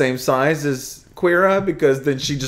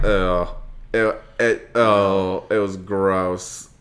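disgusted; 8 semitones lower, 6 dB quieter, much slower than usual. Retold or imitated. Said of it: "and I'm like".

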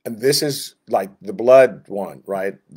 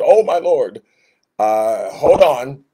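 alarmed protest; 2 semitones higher, 7 dB louder, slower than usual. Acted. Moses recoiling from God's call, startled. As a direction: blurt it out like a man caught off guard.

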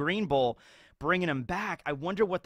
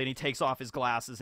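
normal talking voice

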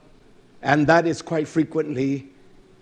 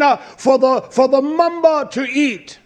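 high-pitched preacher's cry; raised sermon voice; full-force preaching; 10 semitones higher, 6 dB louder than usual. Real, not acted.

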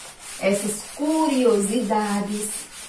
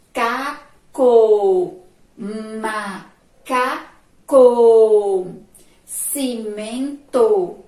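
put-on loud, slow rhyme reading for children; 6 dB louder, much slower than usual. Shown as a demonstration. Teacher reads rhyming words loudly and slowly to children, stretching each word.

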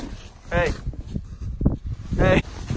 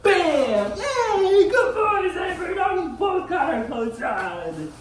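excited; 8 semitones higher, much slower than usual. Real, not acted. Excited praise of the dog.

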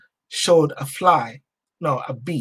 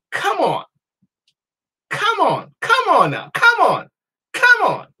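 very high exclamations swooping down; worked-up, repeated high cries; excited urging; 12 semitones higher, slower than usual. Real, not acted. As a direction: egg them on, voice leaping high. Worked up over the slogans.

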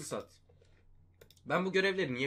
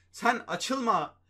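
"Sen açılma" stretched out slowly, higher; mock-patient scolding of the pack; slow sarcastic; 5 semitones higher, 3 dB louder, much slower than usual. Real, not acted.

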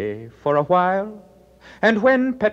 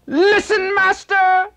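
much higher, loud call to a god; desperate prayer; 5 dB louder than usual. Acted. Wounded Glaucus calls on Apollo, high and loud. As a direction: cry out to Apollo for help. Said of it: "Glaucus prayed to Apollo".

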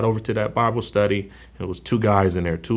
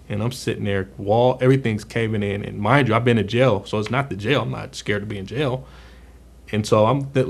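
usual speaking voice

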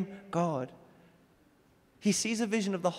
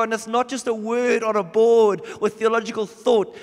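louder, higher, pressing preaching; fervent preaching; 4 semitones higher, 6 dB louder than usual. Acted. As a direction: urge them to see it, building force. Preaching at full force.

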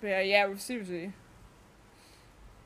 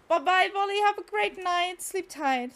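high, loud farewell easing off at the end; bright send-off, pitched high; cheery goodnight; 9 semitones higher, 6 dB louder than usual. Real, not acted. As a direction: call out a friendly goodbye. Calls goodnight to a viewer.

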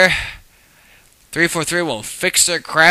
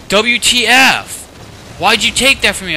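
mock outraged yell; 8 semitones higher, 6 dB louder than usual. Other player accusing him of stealing his ordinance, mock outraged yell. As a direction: throw the accusation back, hammed-up.

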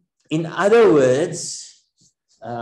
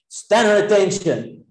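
a mock army order, higher and a bit louder; army commander; 5 semitones higher, 3 dB louder than usual. Preacher mimicking an army commander's order. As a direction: bark it like a drill commander, lightly. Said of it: "like an army commander".

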